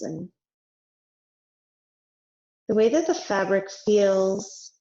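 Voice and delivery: unhurried meditation voice with long pauses; guided meditation. Real, not acted. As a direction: gently guide them into rest, letting each phrase settle.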